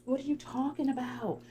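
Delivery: high, mock-innocent woman; feigned innocence. Shayla acting clueless when Arby confronts her, mock innocence. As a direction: play dumb in a high imitation voice. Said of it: "Shayla gets this dumb look".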